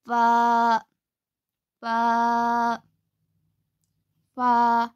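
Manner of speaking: teeth on lip, a clear f in फ़ा; फ़ा: f from teeth and lip, long a; Arabic fa. Shown as a demonstration. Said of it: "दांतों के किनारे को नीचे के होंठ के अंदर के हिस्से को लगाने से फ़ा".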